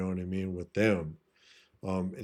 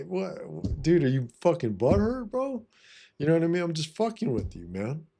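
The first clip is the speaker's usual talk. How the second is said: higher, playful ribbing of a buddy; mock-tease him, all in fun; teasing joke; 7 semitones higher than usual. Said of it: "do it in a loving, kind way".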